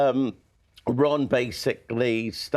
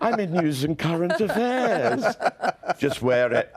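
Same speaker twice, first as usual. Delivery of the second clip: laughing; 4 semitones higher than usual. Retold or imitated. Retelling the jumper argument, laughing.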